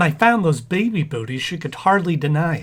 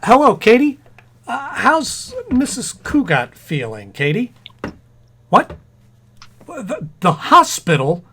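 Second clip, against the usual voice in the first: excited phone call; 6 semitones higher, 3 dB louder than usual. Acted. Husband phones about pregnant wife.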